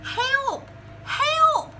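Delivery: high, loud panicked cries; a cat yowling for help; frightened meow. Acted. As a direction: shriek for help like a scared cat. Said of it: "meowed Cat".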